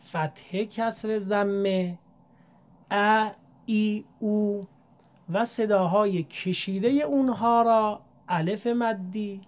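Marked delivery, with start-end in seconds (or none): 2.9-4.7 ا ای او each held long and slow; long vowels demonstrated slowly; drawn long vowels. Shown as a demonstration. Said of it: "صداهای کوتاه،".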